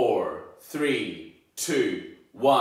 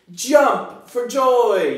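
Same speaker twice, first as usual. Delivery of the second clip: high, loud, slow cheer; excited shout; 11 semitones higher, 10 dB louder, much slower than usual. Acted. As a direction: cheer it out with joy. Loudest excited model.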